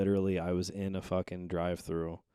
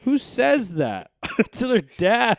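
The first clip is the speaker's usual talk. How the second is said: laughing disbelief; 15 dB louder, slower than usual. Real, not acted. Laughing at own story.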